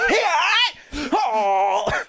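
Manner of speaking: high yelp sinking into a moan; mock pained cry. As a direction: imitate a cartoon hero kicked below the belt. Cartoon Link kicked in the balls.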